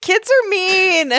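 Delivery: mock sad whine. Real, not acted. Reacting to the sad story.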